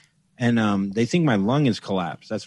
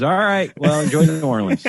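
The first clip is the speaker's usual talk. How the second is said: higher and louder, breezy brush-off; indifferent cop; 5 semitones higher, 5 dB louder than usual. Retold or imitated. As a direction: wave him off like a bored cop. Cop shrugging it off.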